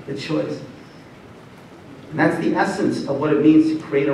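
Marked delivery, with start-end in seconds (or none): 0.1-0.6 hushed emphasis. Acted. Closing the Dumbledore impression.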